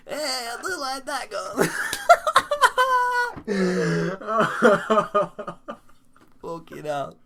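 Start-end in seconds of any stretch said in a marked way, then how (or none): 0.1-1.7 put-on laughing through a Mexican accent; slow, high, giggling accent repeat. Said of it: "The best Mexican accent". 1.7-6.4 helpless high laughter. Real, not acted. Helpless laughter after the joke.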